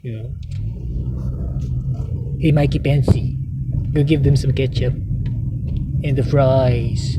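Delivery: put-on mock president voice; McDonald Trump. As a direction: play the president as a burger pusher. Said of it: "that's the way he speaks".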